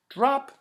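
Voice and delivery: high loud bright drop; loud high droplet call; drop sound. Acted. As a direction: pop the word like a falling drop. Drop landing sound effect.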